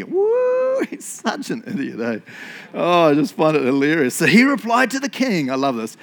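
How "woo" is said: high-pitched drawn-out "woo"; mock whoop. Preacher mocking Haman as such an idiot, whooping. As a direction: let out a high, silly whoop.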